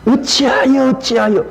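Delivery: 어찌하여 sung out high, then falling; lamenting cry. Shown as a demonstration. Pastor voicing Moses' lament, high and falling. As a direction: plead 어찌하여 with grief. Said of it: "그런 감정을 담아서".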